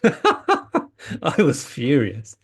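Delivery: laughing through the words, slow; amused, slowed, laughing admission. Real, not acted. Repeats it laughing at himself.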